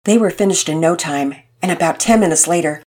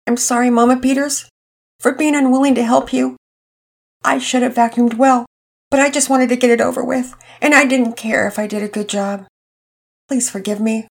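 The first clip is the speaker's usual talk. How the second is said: put-on a boy's higher voice, shaky with remorse; quavering apology; 7 semitones higher than usual. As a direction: beg forgiveness, voice near tears. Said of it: "Pearson's voice was quavering".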